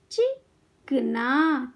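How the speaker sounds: चिकना very slowly, pause after चि; syllable by syllable. Shown as a demonstration. Teacher teaching kids to read, syllables apart. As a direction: break चिकना into चि - कना. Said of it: "शब्द पढ़ना सिखाऊंगी".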